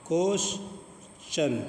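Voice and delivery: क्वेश्चन drawn out very slowly, ch clear; slow question with ch. Shown as a demonstration. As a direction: spell out क्वेश्चन slowly with a ch sound. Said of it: "तो चा बन गया".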